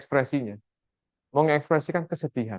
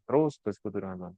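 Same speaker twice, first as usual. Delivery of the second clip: softly repeated; 7 semitones lower, 9 dB quieter than usual. Shown as a demonstration. Preacher repeating his point softly to the congregation. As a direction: echo the phrase much softer, lower.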